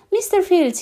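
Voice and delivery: high eager greeting; eager character voice. Acted. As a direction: call out his name, eager. Mister Forgetful greets the farmer.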